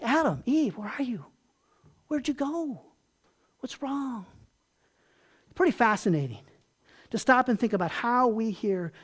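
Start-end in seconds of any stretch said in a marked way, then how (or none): 0.0-4.2 soft, gentle calling out; tender calling God. Pretend. God gently calling hiding Adam and Eve, soft and tender. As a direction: coax them out softly with concern. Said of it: "Or it's like".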